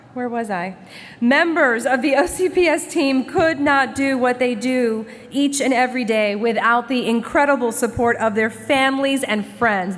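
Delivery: ordinary talk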